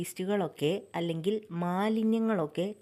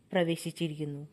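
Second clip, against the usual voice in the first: calm, softer meditation voice with long pauses; guided meditation; 2 semitones lower, 3 dB quieter than usual. Acted. Meditation guide leading listeners through breathing and rising energy, calm and measured. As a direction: guide them gently through each breath and body part, unhurried.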